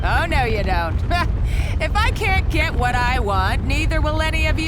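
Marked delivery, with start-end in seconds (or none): 1.0-1.8 evil laugh. Acted. Villain traps everyone.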